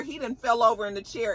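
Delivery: usual speaking voice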